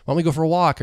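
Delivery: a hint of wife jumping in to cheer him up; imitating caring wife. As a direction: chirp it up as she rushes to help. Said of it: "She might be like".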